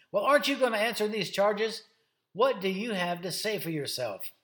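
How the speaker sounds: challenging questioner. Acted. High priest questioning Jesus about the charges, challenging. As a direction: confront someone who won't answer.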